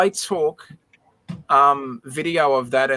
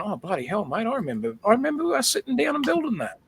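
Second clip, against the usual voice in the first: imagined dad, lit up and rising higher with joy; imitating excited dad; 9 semitones higher, 3 dB quieter than usual. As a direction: voice climbing much higher as the memory hits. Imagined dad recalling the set.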